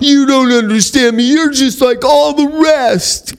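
imitating his younger self lashing out at the counselor; mock angry retort. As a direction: throw it back like a defensive addict. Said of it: "That's what I would have said".

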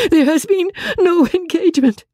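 very high, anguished protest; distressed cry. Acted. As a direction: insist in a high wail. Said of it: "cried Marianne".

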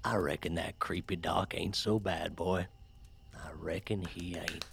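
soft, subdued afterthought after a rant; quiet musing. Acted. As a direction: mull it over under your breath.

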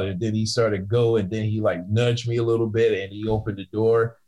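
ordinary talk, nothing marked

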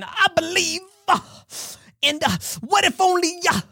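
high-pitched preacher impersonation, punched word endings; T D Jakes. Retold or imitated. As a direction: mimic a famous pastor's preaching cadence. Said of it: "I started acting like T D Jakes".

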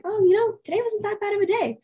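light, sunny recap of the day; cheerful self-talk. Retold or imitated. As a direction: reassure yourself that the day went fine. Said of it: "and I'm like".

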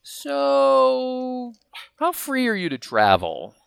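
drawn-out, high 'so', then hesitant fishing; high-pitched mock-casual sounding-out, words stretched; cagey caller. Grand master feeling out a friend by phone, cagey and hesitant. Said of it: "calls me up and goes".

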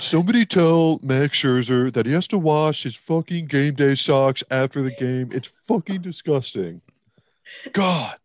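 loud, high, hammed-up disgusted complaint; mock-outraged tattling. Pretend caller tattling on a player to snitch hotline, mock-outraged. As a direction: vent like an exasperated tattletale, ending on a high groan.

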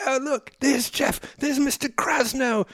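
exaggerated high-pitched British mimicry; mocking British accent. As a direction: tease him by imitating his British accent, way up high. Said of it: "they start mocking my British accent".

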